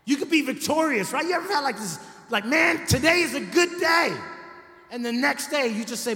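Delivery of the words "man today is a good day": higher and louder happy shout; mock triumph. Person on a great day, shouting happily in mock triumph. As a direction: crow it out like a winner. Pastor acts out a victorious day.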